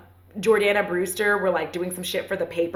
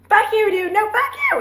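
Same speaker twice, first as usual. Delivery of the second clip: high, loud mock bickering yell; hammed-up squabble of a fighting couple; 14 semitones higher, 6 dB louder than usual. An angry couple screaming at each other, high mock shout.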